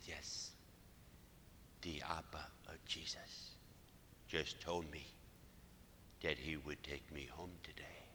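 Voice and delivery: slow, hushed imitation, Mexican-American accent; whispery and reverent, with a slight Mexican-American accent. Dying woman confiding in her priest, soft Mexican-American accent. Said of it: "still has a slight accent".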